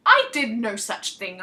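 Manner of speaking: a soldier firmly denying he attacked anyone; insisting. Acted. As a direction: lean on every word, refusing the accusation. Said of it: "I insisted".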